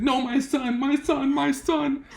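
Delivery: high-pitched wail of a distraught dad; mock sobbing. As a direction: imitate a father crying out, hammed-up. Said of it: "He's sobbing".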